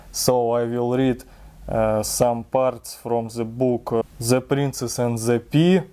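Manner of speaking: ordinary delivery, nothing marked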